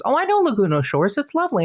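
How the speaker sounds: high, approving lilt; Keith Morrison. Retold or imitated. TV idol approves fan's timeshare, high and admiring. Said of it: "He goes".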